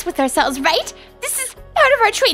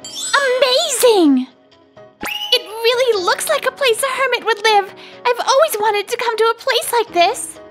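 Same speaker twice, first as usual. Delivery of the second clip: excited, pitched-up admiration; thrilled wonder. Acted. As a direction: marvel with childlike delight. Thrilled by the hermit's cottage.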